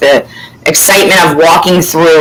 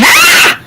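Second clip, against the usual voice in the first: high-pitched scream; retold scream. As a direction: reenact a panicked scream, very high. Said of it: "and I scream".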